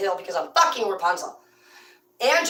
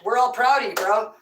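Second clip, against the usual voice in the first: ordinary talk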